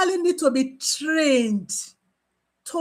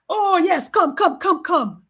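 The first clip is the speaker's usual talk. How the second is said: a hint of a taunting tough guy, higher; mock macho man; 4 semitones higher than usual. Lightly mocking a nurse squaring up to an aggressive patient. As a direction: lightly play the macho challenger. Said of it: "Don't go and do macho man".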